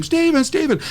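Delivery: high-pitched mock searching call; imitated calling, pitched high; calling his name. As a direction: call out for a lost boy without real volume. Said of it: "I'm calling his name".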